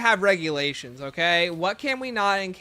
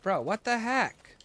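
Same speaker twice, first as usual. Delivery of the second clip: exasperated; 7 semitones higher, slower than usual. Real, not acted. Dismayed at missing shock stick.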